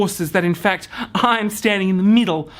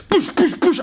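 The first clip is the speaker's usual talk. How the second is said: much higher, pushing himself on in panic; frantic push push push; panicked urging; 10 semitones higher than usual. Retold or imitated. Hearing a horse running at him.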